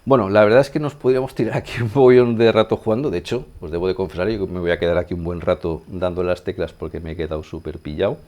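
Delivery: ordinary talk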